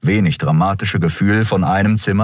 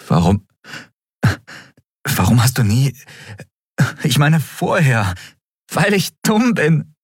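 laughing into sobs; 7 semitones higher than usual. A narrator's reading. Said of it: "lachte und weinte gleichzeitig".

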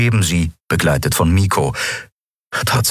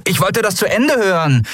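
put-on higher, indignant young voice; protesting; 14 semitones higher than usual. A narrator's reading. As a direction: protest like a kid cut off. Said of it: "protestierte Miko".

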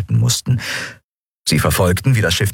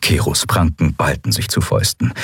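usual speaking voice

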